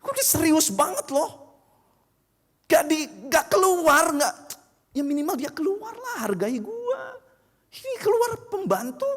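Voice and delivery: high-pitched offended complaining; indignant rant. Acted. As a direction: play the insulted VIP ranting. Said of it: "dia kesel".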